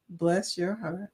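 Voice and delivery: put-on soft, slow old Southern lady. Shown as a demonstration. Showing how an old lady from the South says it, soft and slow. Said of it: "they say it in a specific way".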